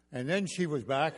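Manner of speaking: usual speaking voice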